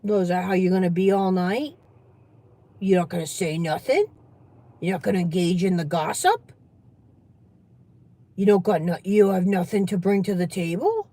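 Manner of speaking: lightly voiced prying relative, low with rising questions; low, needling questions ending on a lift; nosy relative. Plays nosy relative grilling Trace.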